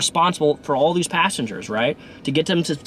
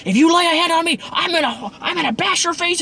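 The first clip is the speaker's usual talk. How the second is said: screaming threat. Retold or imitated. Angry passenger screaming at a drunk woman. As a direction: shout him down, ready to fight. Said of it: "the guy starts screaming".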